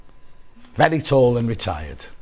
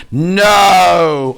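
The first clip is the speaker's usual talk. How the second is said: mock shocked no; 3 semitones higher, 8 dB louder than usual. Real, not acted. Shocked at a fabric.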